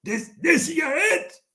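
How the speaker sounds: ordinary talk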